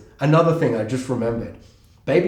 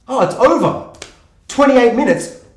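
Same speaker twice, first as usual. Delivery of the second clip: high, loud disbelief; re-enacted shock, pitched well up; retold dismayed surprise; 8 semitones higher, 5 dB louder than usual. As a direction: exclaim it as a let-down viewer. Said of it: "I'm like".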